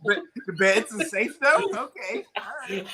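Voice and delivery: high mock-surprised dog, jumping up on the question; dog checking permission. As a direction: play the dog warily accepting a new rule. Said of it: "It was like".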